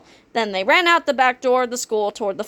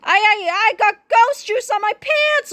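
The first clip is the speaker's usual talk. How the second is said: high, wailing sob; tearful wail; 11 semitones higher than usual. A narrator's reading. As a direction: wail it like a crybaby. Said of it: "Loogie wailed as he burst into tears".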